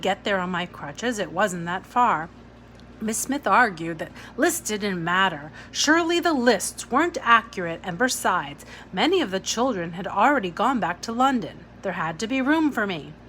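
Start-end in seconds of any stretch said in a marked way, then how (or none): none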